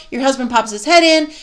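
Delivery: ordinary talk, nothing marked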